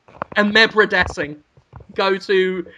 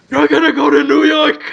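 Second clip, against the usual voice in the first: loud, higher mock Pacino; Al Pacino impression; 8 semitones higher, 6 dB louder than usual. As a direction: yell it like a hammy Pacino. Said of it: "I can't do him at all".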